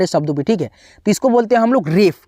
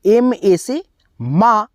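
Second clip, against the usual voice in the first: slow spelling; much slower than usual. Shown as a demonstration. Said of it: "तो यहाँ पे हम लोग लिखेंगे".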